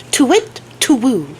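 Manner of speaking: high hooting owl call, first take. Retold or imitated. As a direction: hoot it like an owl up in the rafters. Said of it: "she makes a very specific sound".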